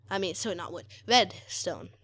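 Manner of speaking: normal talking voice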